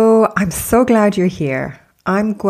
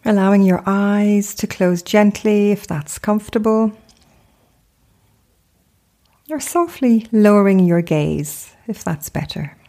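guided meditation. Acted. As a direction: gently guide them into stillness, unhurried, phrase by phrase.

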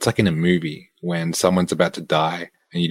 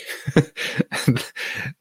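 a short airy burst of laughter; breathy laugh; 6 semitones higher, 4 dB quieter than usual. Real, not acted. Laughs at friend's shame walk.

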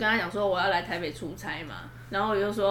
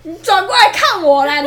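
loud call; 9 semitones higher, 14 dB louder than usual. Real, not acted. Telling friend to face mic.

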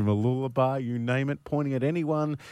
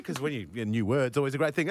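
ordinary talk

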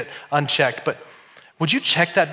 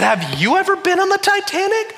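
high, lightly voiced wife; wife's question. Wife teasing pastor, high lightly voiced question. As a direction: quiz him, teasing. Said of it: "She said".

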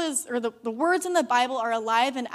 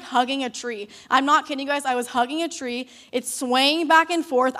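usual speaking voice

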